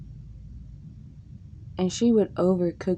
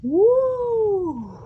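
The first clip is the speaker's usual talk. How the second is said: very high, louder whoop; cheer; 14 semitones higher, 4 dB louder than usual. Real, not acted. Celebrating escaping grandma's house, high loud whoop. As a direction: whoop with relief.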